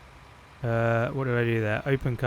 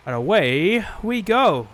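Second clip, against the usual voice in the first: excited; 5 semitones higher, 6 dB louder, slower than usual. Pretend. Setting off in the tractor.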